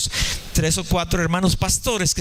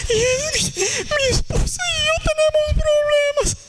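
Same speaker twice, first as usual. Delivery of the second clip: much higher, wailing mock sob; hammed-up crying drama. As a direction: overplay the tearful confession. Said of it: "no me dejó terminar el drama". (drama llorón exagerado, muy agudo)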